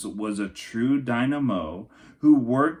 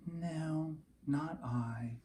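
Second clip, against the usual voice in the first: soft refusal; 5 semitones higher, 11 dB quieter, much slower than usual. A narrator's reading. Rosie refuses, sad.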